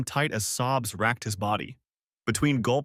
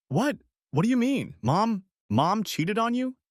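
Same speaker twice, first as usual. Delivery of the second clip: put-on much higher, a teenage son in shock; can't believe what he's hearing, voice jumping up; stunned disbelief; 11 semitones higher than usual. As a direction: son reeling at news of his mother's affair, disbelieving. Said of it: "He said it like it was the most impossible thing in the world".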